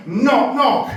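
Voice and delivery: posh caveman telling a knock-knock joke. Acted. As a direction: deliver it as a grand opener.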